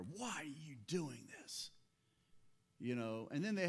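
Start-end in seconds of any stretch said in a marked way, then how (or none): 0.0-1.7 softer, hushed reproach; acted judging. Preacher playing the men judging the woman, hushed reproach. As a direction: question her with restrained, accusing disapproval. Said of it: "judging her".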